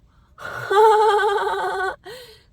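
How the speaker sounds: long, high, loud cackle; loud laugh. Real, not acted. Vlogger admitting her pants are wet, loud high laughter. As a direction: burst into a sustained high laugh.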